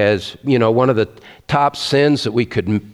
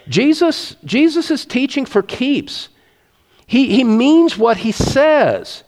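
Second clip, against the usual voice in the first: impassioned preaching; 10 semitones higher than usual. Real, not acted. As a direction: pitch jumps far higher, voice soaring.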